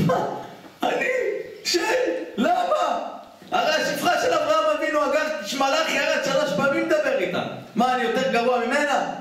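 high, wailing mock cry with a laugh in it; crying in protest. Rabbi wailing to heaven at being sent a demon. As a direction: whine it up high, hurt and half-laughing. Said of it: "התחיל לבכות".

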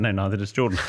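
ordinary delivery

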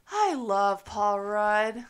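slow, dazed and dreamy; drowsy anesthesia voice. Retold or imitated. Wife imitating her groggy husband after surgery, slow and dazed. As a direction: drift through it, still half under. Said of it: "anesthesia haze you were under".

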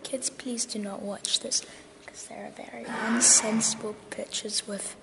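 whispering. Real, not acted. Repeating the whispered warning.

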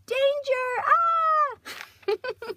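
high, loud cartoon cry of alarm; cartoon girl in danger. Actress voicing her movie character escaping the bad guys, shouting. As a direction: yelp it like a kid hero fleeing bad guys.

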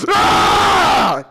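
loud high scream, falling away; sudden full-voiced shriek; startled scream. Real, not acted. Player startled by the game, screaming.

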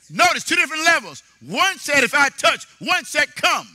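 high, loud preaching with shouted peaks; fiery sermon climax; full-force preaching. Acted. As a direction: drive the faith message home to the congregation.

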